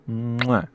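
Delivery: loud smacking air kiss; kiss sound. Real, not acted. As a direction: blow a big kiss into the mic. Blowing a kiss to chat.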